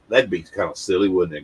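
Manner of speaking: usual speaking voice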